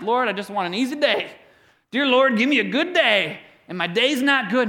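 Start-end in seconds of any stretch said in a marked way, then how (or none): 0.0-3.4 mock whiny prayer. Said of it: "And I'm like".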